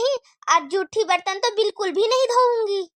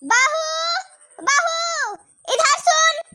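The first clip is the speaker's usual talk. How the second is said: angry call; 8 semitones higher, much slower than usual. Acted. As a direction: yell for the daughter-in-law in anger. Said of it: "इतने गुस्से में क्यों चिल्ला रही हैं".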